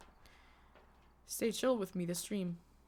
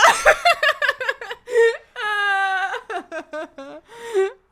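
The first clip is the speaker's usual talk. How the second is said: high, loud burst of laughing; helpless laughter; 12 semitones higher, 12 dB louder than usual. Real, not acted. Streamer laughing loudly after a fright.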